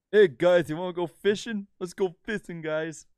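high mock-cheerful fishing buddy; goofy fisherman voice. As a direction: chirp it like an eager fishing pal. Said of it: "his car was the equivalent of".

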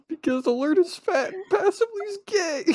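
very high, squealed mock-crying words; hammed-up wailing. Pizza worker explaining why he broke down, mock wailing. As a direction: wail it through exaggerated sobs. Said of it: "some tears out of my eyes".

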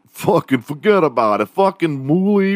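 high-pitched New Yorker caricature; mock New York accent. Mimicking everybody in New York City, hammed-up New York accent. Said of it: "go to New York City and everybody's like".

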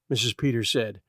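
plain reading voice

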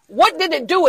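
usual speaking voice

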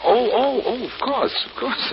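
high, flustered reassurance; stammering, high-pitched agreement. Acted. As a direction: caught off guard, hastily agree. Startled out of distraction, hastily agrees.

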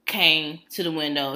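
normal talking voice

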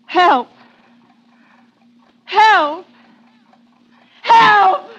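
panicked cries, building to a scream; screaming for help. Acted. Trapped woman screaming for help.

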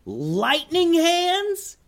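very high, drawn-out squeal of a yell; Sam's excited yell. A narrator's reading. Sam yells about Harry's lightning powers, high drawn-out yell. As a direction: stretch it out in a sky-high character voice. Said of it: "yelled Sam".

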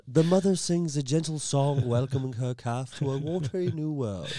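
put-on nature documentary voice; documentary narrator. TV playing a whale documentary, imitated narrator. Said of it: "the T V playing a documentary on whales".

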